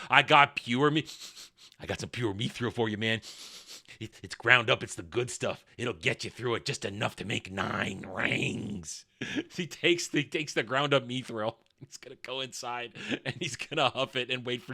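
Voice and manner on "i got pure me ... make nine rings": low, quiet shady dealer; Sauron. Retold or imitated. The deceiver offering mithril like a dealer, low confidential mock pitch. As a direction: pitch the goods under your breath. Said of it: "Oh, he says also".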